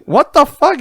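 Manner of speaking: high-pitched retold outburst; astonished disbelief. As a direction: act out a baffled parent. Retold surprise at onion ring order.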